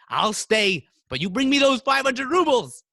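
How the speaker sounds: high, loud condition; firm demand. Acted. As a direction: insist loudly on the money. Mushka sets his condition.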